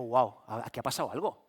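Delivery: hushed worry. Retold or imitated. Hushed worried reaction.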